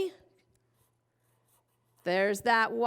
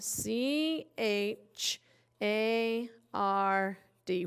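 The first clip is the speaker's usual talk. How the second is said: slow spelling; 4 dB quieter, much slower than usual. Shown as a demonstration. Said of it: "slow spelling".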